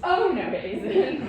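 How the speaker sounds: loud slow laughing oh no it isn't; panto crowd callback. Acted. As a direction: boo it back like a panto crowd.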